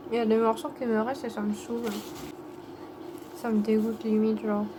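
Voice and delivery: tired, swollen-mouth mumble; after wisdom teeth. Real, not acted. Exhausted, in pain after surgery.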